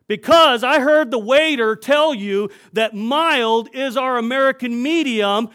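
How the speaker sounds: loud, high scolding manager; manager's loud scolding. Retold or imitated. Manager loudly scolding the pastor in front of the restaurant. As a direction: bark it at the customer like a scolding manager. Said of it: "and he got loud".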